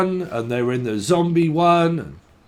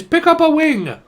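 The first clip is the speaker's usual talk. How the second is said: mock Chinese accent; 11 semitones higher, 6 dB louder than usual. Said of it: "what the fuck is that accent?".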